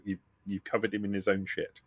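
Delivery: usual speaking voice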